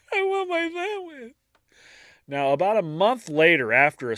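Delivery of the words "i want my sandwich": very high hammed-up whimper; squeaky fake-tearful voice; mock crying. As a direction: whine it like a pretend crybaby. Mock lament over a sandwich.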